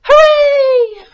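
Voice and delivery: high, loud, distant hooray; far-off echo cheer; echoing shout. Acted. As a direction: shout it back like an echo from afar. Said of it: "the echo shouted back to them".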